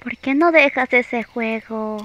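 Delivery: ordinary delivery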